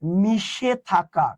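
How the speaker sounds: মিশে থাকা drawn out very slowly; drawn-out teaching emphasis on মিশে থাকা. Shown as a demonstration. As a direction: stretch each syllable of মিশে থাকা for emphasis. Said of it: "এই লীন শব্দের অর্থ হচ্ছে".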